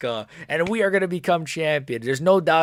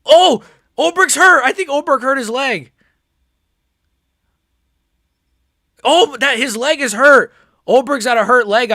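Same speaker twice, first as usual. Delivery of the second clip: excited shout; 10 semitones higher, 10 dB louder than usual. Real, not acted. Fighter injured mid-fight.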